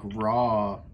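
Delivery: ordinary talk, nothing marked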